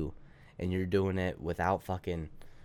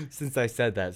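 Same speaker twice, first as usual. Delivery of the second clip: laughing for lack of air, higher and louder; winded laughter breaking into the words; breathless laugh; 9 semitones higher, 6 dB louder than usual. Real, not acted. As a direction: laugh through being out of breath. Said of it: "I just haven't breathed".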